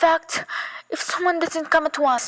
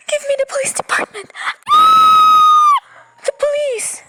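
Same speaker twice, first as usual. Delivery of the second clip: panicked shout; 9 dB louder than usual. Acted. Screaming for police.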